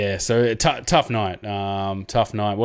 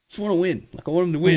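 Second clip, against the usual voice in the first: eager; 8 semitones higher, faster than usual. Retold or imitated. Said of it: "you kinda like".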